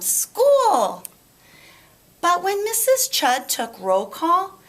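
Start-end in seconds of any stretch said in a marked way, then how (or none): 0.0-1.0 high and louder joyful shout; excited shout. Acted. Chrysanthemum shouting with joy about school, excited. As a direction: shout it with delight. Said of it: "said Chrysanthemum".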